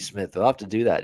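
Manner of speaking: ordinary talk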